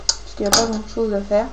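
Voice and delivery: ordinary talk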